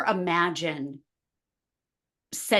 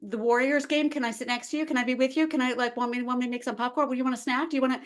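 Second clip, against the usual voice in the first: playfully over-attached mom, pitched up; clingy pestering; 6 semitones higher, much faster than usual. Acted. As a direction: tumble out needy questions without stopping. Clingy mom pestering her son.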